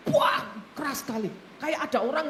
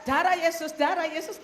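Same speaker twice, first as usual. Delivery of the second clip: mimicked praying; 4 semitones higher, 4 dB louder than usual. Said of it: "gitu, Saudara".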